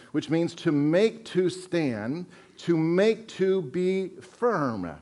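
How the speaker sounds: slow, deliberate defining; slow definition. Shown as a demonstration. Pastor explains Greek word to church, slow and deliberate. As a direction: spell out the meaning slowly. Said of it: "original Greek language".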